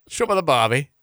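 laughing, badly faked Cockney accent; mock Cockney. Host demonstrates Cockney accent for co-host, jokey imitation. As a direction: put on a silly Cockney accent through a laugh. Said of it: "talking in a Cockney accent".

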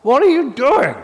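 angry apostle scolding his church; furious Paul. Acted. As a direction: tear into them like an outraged apostle. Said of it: "his angriest letter".

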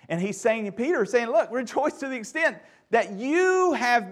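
loud, high, driving sermon voice; fervent loud preaching to the church; full-force preaching. Real, not acted. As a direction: preach it out to the congregation.

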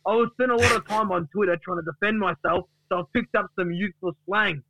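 high, animated comic boasting; hyped-up Duck. Acted. Duck explains his new slang to Gary, hyped-up boast. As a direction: pitch it up, cocky and excited. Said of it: "And he said".

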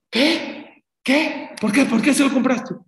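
high, loud startled questions; alarmed wife. Retold or imitated. Wife to husband over the police toy car, alarmed. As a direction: voice the wife's sudden alarm.

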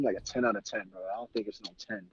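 usual speaking voice

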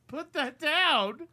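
high, slow firm order; loud high scolding command; sharp command. Acted. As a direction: order him to drop it. Commanding the patient.

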